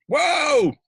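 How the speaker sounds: very high, loud whooping "whoa"; mock-excited "whoa". Host signing off the car webinar, whooping playfully. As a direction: whoop it out like a silly cheer.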